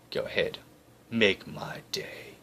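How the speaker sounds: slow, whispered threat; menacing whisper. Acted. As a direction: dare him quietly, very slowly. Jackson's tough-guy reply.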